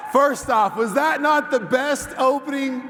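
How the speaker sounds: loud, high excited cheering; excited shouting. Real, not acted. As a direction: fire up the crowd. President greets cheering convention crowd.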